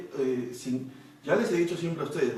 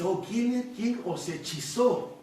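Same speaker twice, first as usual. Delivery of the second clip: higher-pitched, slow rebuke; mock reproach; 5 semitones higher, slower than usual. Preacher voices Paul confronting the Galatians, drawn-out reproach. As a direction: call them out, drawing the question out. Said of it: "empieza a llamarles la atención".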